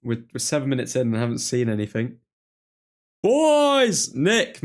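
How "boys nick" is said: big high shout of welcome; excited greeting shout. Real, not acted. Greeting friends joining the stream.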